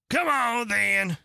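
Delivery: high, loud bellow; a queen roaring across the field. Acted. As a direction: roar the invitation like a queen. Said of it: "roared the queen".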